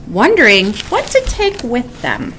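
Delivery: usual speaking voice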